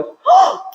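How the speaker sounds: high, sharp mock gasp; acted startled intake; shocked gasp. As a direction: gasp in sudden recognition. Father gasps on seeing the token.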